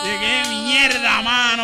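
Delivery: high, loud, slow outburst; loud exclamation. Real, not acted. Comedian recalls his sister seeing the bad show, loud outburst. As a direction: blurt it out in loud disbelief.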